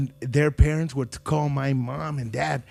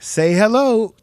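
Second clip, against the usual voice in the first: party line call; 4 semitones higher, 7 dB louder, much slower than usual. Retold or imitated. Party line greeting again.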